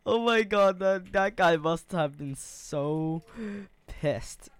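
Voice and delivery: loud words through laughter; giggling while talking, slowed; laughing hard. Real, not acted. Gamer laughing that the opponent must be pissed, laughing.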